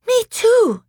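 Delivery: put-on very high, loud child's cry; distressed cry. Lucy agreeing as the pulling returns, distressed cry. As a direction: cry out in alarm.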